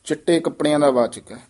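ordinary talk, nothing marked

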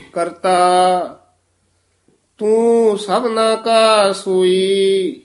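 slow scripture recitation, long held endings; Gurbani ਪਾਠ. Acted. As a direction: recite each phrase slowly, stretching the vowels. (ਹੌਲੀ, ਲਮਕਾ ਕੇ ਗੁਰਬਾਣੀ ਪਾਠ)

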